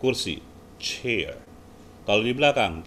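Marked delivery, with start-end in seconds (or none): 0.0-1.4 "chair" slowly and carefully; slow model chair. Shown as a demonstration. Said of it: "Seperti kita bilang".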